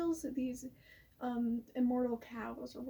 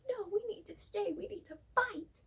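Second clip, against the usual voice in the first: Odysseus insisting; 8 semitones higher than usual. Retold or imitated. Odysseus voiced insisting on fighting.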